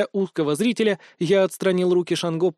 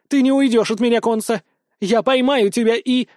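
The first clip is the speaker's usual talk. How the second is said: louder, higher calling after someone; shouted threat; 6 semitones higher, 5 dB louder than usual. A narrator's reading. Nastya threatens the escaping hero, shouting. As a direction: yell after him in a raised voice.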